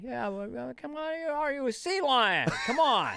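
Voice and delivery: hammed-up Biden, pitched high; Biden impression. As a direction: push the pitch way up and bluster like Biden. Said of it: "But Biden be like".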